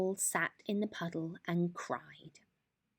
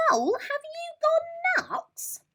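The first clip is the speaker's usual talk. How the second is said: very high, slow, squeaky squirrel voice; a squeaky little squirrel yelping; 7 dB louder than usual. A narrator's reading. Squirrel, hit by an acorn, squeaks at Mole, very high.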